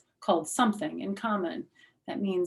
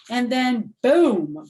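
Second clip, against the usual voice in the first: dramatic boom; 5 semitones higher, 9 dB louder, much slower than usual. A narrator's reading. Dramatic story beat.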